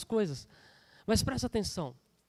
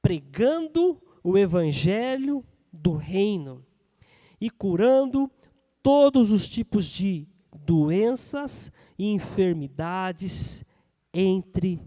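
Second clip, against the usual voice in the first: slow, emphatic proclamation with long pauses; preached scripture reading; 7 dB louder, much slower than usual. Real, not acted. Preacher reads the gospel to the church, slow proclamation. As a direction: proclaim each phrase, drawn out and weighty.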